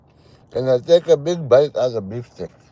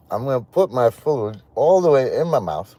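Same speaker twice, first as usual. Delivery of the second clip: ordinary delivery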